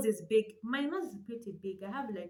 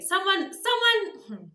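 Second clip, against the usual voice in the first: loud, high, drawn-out call for help; a slow, loud plea for someone to come; 9 semitones higher, 9 dB louder, much slower than usual. Real, not acted. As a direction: call across the room, stretching it out. Calling for help with ring light.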